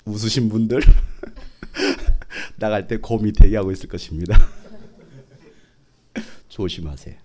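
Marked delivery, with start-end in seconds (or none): 0.9-2.5 breathy chuckle at his own joke. Real, not acted. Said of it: "laughs at own joke". 2.6-7.2 low, laughing through the words; laughing warning. Real, not acted. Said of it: "joking warning".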